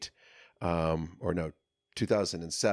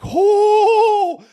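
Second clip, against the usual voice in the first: much higher, loud stretched oh; reacting to finally being freed; elated oh; 16 dB louder than usual. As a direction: let the joy burst out. Joy at release.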